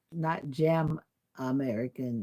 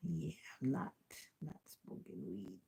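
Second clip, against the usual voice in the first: whispered aside; 12 dB quieter than usual. Real, not acted. To viewers about weed, a whispered aside. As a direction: whisper it, much softer.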